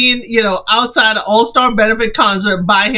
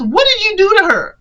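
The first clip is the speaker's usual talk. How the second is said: much higher, fast demand; 10 semitones higher, faster than usual. Retold or imitated. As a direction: fire the question up high. Jacqueline demanding.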